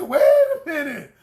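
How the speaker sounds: laughing wait a minute; mock surprised. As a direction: stop them short, amused disbelief. Coach retells stopping client.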